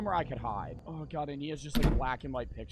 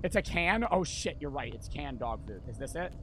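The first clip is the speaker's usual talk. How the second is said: ordinary talk, nothing marked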